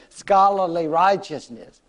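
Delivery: ordinary delivery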